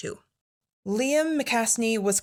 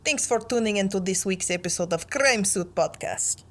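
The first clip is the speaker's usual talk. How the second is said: a lightly put-on Russian accent for the podcast sign-off. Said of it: "You should do the sign-off in a Russian accent".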